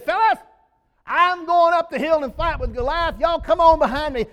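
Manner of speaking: high, loud rallying shout to the men; rallying call. Pretend. Preacher voicing Saul rallying his men, loud and high. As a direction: call the troops up the hill.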